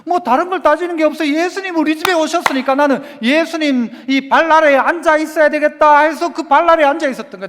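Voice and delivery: ordinary talk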